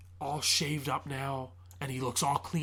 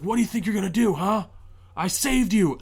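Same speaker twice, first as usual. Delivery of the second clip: louder, higher retold challenge; indignant, raised-voice demand; angry confrontation; 7 semitones higher, 7 dB louder than usual. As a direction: imitate an angry man cornering someone. Man confronts fleeing girl, retold.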